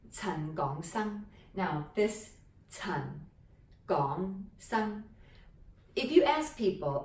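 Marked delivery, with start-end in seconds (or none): none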